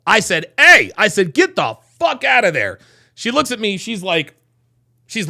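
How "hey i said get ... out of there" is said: loud, raised-pitch order to leave; retold angry outburst. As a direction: re-enact seeing red and ordering her out. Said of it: "I saw red".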